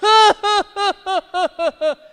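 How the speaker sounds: put-on loud, high toddler ha-ha-ha; forced laugh, over and over; imitated fake laugh. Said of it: "'cause Landon's going".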